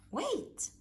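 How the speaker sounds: high, sudden squeak of a tiny mouse; little mouse shouting wait; squeaky mouse. Acted. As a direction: cry out small and quick.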